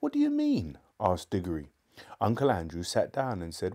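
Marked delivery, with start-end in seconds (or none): 0.0-0.8 very high-pitched, urgent question; alarmed question. A narrator's reading. Diggory presses his uncle to explain, alarmed. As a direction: ask it urgently, voice leaping up.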